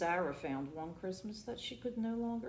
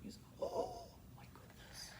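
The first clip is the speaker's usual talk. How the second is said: soft dismay; 11 dB quieter than usual. Real, not acted. Reader reacting to sad picture.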